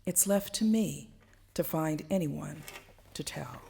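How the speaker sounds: lively, swelling poetry performance; spoken-word poem, rising and falling with the tale. Acted. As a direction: tell it like a story to a full room. Performs her second poem.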